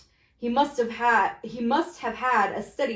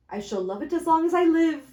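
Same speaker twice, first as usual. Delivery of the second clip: raised, joyful exclamation; cried with joy; 6 semitones higher, 4 dB louder than usual. Acted. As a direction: gush over the knocker. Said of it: "cried Scrooge".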